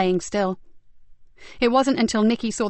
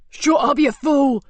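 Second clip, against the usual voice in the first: angry high yell; furious scream; 5 semitones higher, 6 dB louder, slower than usual. Acted. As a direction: bark it at the fool. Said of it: "screamed Cox".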